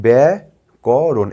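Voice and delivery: ব্যাকরণ drawn out as ব্যা, ক, রণ; teacher slowly splitting ব্যাকরণ into syllables. Shown as a demonstration. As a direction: say ব্যাকরণ in three slow, separate beats. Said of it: "আস্তে আস্তে করে বলছি".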